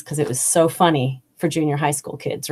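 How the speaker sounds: normal talking voice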